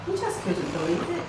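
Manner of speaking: plain reading voice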